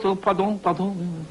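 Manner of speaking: put-on lightly French-accented, politely sorry; French hotel guest. Frenchman apologising for a wrong number, imitated French accent. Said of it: "Guy goes".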